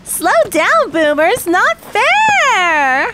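high, whining yell after rivals; calling out in protest. Acted. Racer protesting to the boomers ahead, high wailing shout. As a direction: cry out high, stretching the last word.

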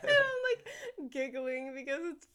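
talking through real giggles, pitch high; real giggling. Real, not acted. Teacher giggling while telling a colleague about a student. Laughing while retelling the funny moment.

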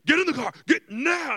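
loud, high barked command; hammed-up, insistent yell; evil mom voice. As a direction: act out a furious mom ordering a kid. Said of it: "straight up Mortal Kombat voice".

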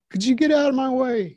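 high, put-upon plea to get past; exasperated high mock plea. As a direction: squeak it out like a harassed victim. Blocked from truck, asks her move.

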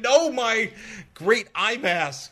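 high, drawn-out mock protest; mock dismay. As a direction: wail in pretend dismay over a treasure. Acted dismay over ruined mask.

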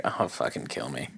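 low, soft defeated groan; deadpan despair, barely projected; miserable polar bear. Retold or imitated. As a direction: voice a bear who has given up. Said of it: "He's like".

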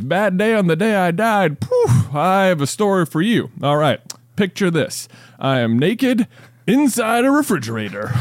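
high, tearful whine of a wronged man; mock crying. Third dead man whines his sad story through mock tears. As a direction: blubber it out, near tears.

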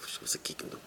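unvoiced whisper, very soft; hushed aside. Mock astonishment at old news. (שטיל געשעפטשעט)

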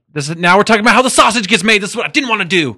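high, rapid exasperated rant; exasperated outburst. Real, not acted. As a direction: let frustration burst out, pitched high. Exasperated at talk drifting to production.

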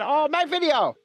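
hammed-up Chinese-accented exclamation. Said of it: "trying to do a Chinese accent".

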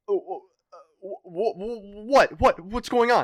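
high, loud stuttering panic; panicked stammer. Retold or imitated. Held at knifepoint by a friend, panicked high stammer. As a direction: stammer in terror. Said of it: "fucking shitting bricks".

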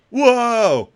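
loud high whoa; amazed. Real, not acted. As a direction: gasp in awe. Amazed at picture.

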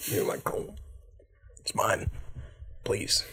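hushed, whispery banshee; banshee plea. Acted. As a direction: plead softly like a ghost. Voicing the banshee wanting her comb.

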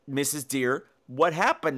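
ordinary talk

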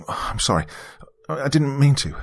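lightly flustered apology; quick stammer. A narrator's reading. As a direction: stumble a little into an apology. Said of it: "I stammered quickly".

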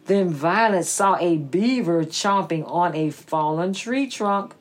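ordinary delivery, nothing marked

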